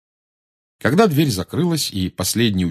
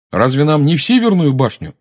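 louder, higher puzzled question; surprised; 6 semitones higher, 6 dB louder than usual. A narrator's reading. Ron queries Parvati about the classroom, surprised. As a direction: ask it in open surprise, voice lifting. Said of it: "удивился Рон".

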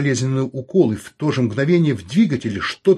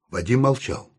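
plain reading voice

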